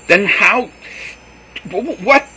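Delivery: high, loud, stammering; can't get words out; sputtering fury. A narrator's reading. Joseph sputtering in rage.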